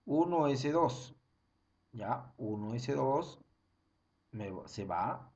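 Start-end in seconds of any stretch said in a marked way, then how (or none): none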